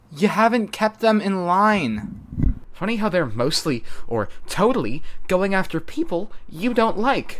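high, loud accusing yell; shouting. A narrator's reading. Verona shouting at Bristow through her folded arms. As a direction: shout your accusation across the clearing. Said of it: "shouting into the crooks of two elbows".

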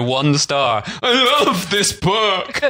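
slow, high dopey voice; dopey reviewer. Retold or imitated. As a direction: play a confused old reviewer, dragging it out. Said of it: "and then go".

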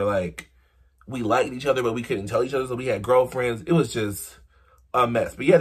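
ordinary talk

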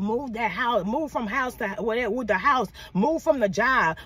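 ordinary talk, nothing marked

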